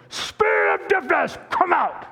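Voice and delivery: booming, high-pitched faith healer command; television preacher. Retold or imitated. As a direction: cast it out like a TV preacher. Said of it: "television preachers".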